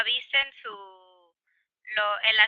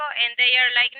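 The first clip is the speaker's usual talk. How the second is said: ordinary talk, nothing marked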